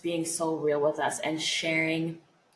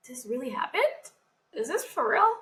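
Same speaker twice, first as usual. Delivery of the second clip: rising higher, marvelling at the question; amazed disbelief; 6 semitones higher than usual. Retold or imitated. After her transplant, marvelling in high disbelief. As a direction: imitate her own astonished self. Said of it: "I'm still every other day like".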